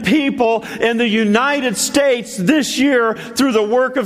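high, ringing preacher voice; fiery preaching climax; full-force preaching. Real, not acted. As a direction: preach it up to the congregation.

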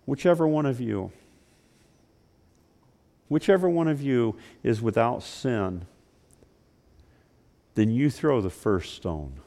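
Jesus quietly answering the accusers. Acted. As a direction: say it gently, hushed. Jesus voiced softly.

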